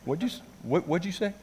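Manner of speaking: soft, high, halting question; imitated baffled friend. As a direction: stumble into a puzzled question.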